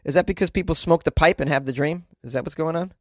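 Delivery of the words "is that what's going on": usual speaking voice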